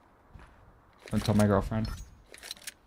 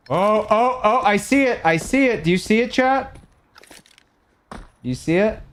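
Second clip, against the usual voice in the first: thrilled to finally see it, calling chat in; oh I see it, pitched way up; excited discovery; 8 semitones higher than usual. Real, not acted. Spotting the pearlescent shine live.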